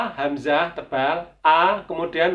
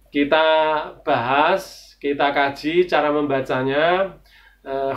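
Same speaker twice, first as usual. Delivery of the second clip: ordinary talk, nothing marked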